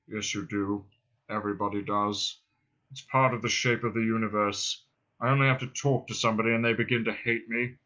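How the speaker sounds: low, self-pitying drone; depressed robot. Acted. Marvin accuses Ford of hating him, morose drone. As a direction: drone it wearily, without hope.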